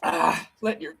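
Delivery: coughing and choking on milk; sputtering after inhaling milk; choking on a drink. Real, not acted. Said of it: "I'm choking".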